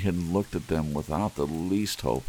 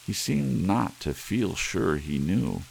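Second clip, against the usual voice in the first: ordinary delivery, nothing marked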